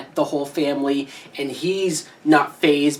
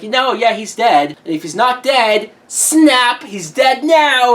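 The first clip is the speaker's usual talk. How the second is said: retold killer, building higher and louder; punchy mock-manic killer outburst; crazed killer; 7 semitones higher, 6 dB louder than usual. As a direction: imitate a killer escalating into crazy mode. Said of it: "he goes full crazy mode".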